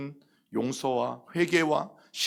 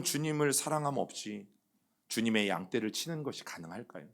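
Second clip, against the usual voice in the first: ordinary talk, nothing marked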